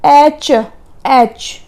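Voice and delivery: एच stretched very slowly, vowel first; drawn-out letter. Shown as a demonstration. Teacher to students, stretching letter H: एच very slowly. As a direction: draw out एच so the ए leads. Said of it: "एच बोलने के लिए हमें ए पहले बोलना".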